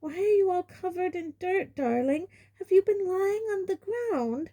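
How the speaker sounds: high doting mother; fretful and fussing; mother fussing over dirty son; Aunt Petunia. A narrator's reading.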